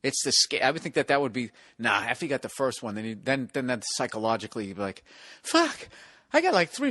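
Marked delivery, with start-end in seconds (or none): 5.4-6.9 panicked. Pretend. Imagined panic after first hit.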